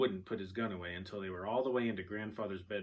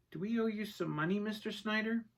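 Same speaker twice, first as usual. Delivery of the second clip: put-on high young boy voice; little boy's question; 12 semitones higher than usual. A narrator's reading. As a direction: ask it high, as a child. Story's boy asks the tax man.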